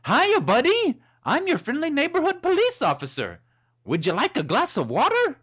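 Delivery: high, sing-out friendly officer; cheerful good cop. Acted. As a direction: greet with over-the-top high cheer. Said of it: "Face changes to the cheerful good cop".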